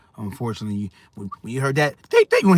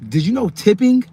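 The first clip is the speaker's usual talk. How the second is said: excited question; 8 semitones higher, 5 dB louder than usual. Real, not acted. Rhetorical quiz to viewers.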